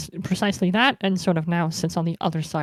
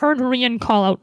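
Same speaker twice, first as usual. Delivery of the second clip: ordinary delivery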